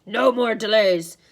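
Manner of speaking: slow, loud monster order; impatient monster. A narrator's reading. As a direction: lay down the law as a monster. Impatient Cyclops orders Grover.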